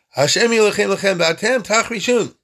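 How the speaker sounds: high, loud proclamation carried far; turned-up loud voice. Acted. Moshe calling across all of Egypt, turned-up loud voice. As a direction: project it over a vast crowd. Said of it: "he could turn it up there".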